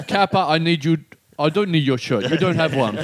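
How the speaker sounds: mock Austrian action-hero voice; put-on Terminator impression; Arnie. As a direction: deliver it as a cyborg action star. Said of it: "Some wonderful Arnie's just there".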